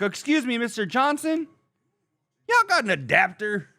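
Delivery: high, put-on mocking voice; mock the worker's request; mock polite worker. Homeowner mocking the worker's adapter request in a high voice. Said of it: "Never once have I been like".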